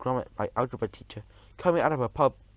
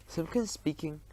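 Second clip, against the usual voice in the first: higher, softer self-important phone greeting; lightly put-on official phone voice; important telephone voice; 4 semitones higher, 6 dB quieter than usual. A narrator's reading. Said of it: "very important telephone voice".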